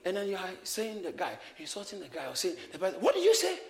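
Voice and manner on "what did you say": high, loud, affronted demand; angry challenge. Acted. Insulted man confronting the other, angry challenge. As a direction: fire it back like a challenge. Acted quarrel over an insult.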